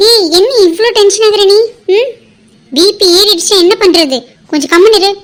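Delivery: firm and loud, a bit exasperated; scolding doctor. Acted. Doctor scolding the panicking mother, loud and firm.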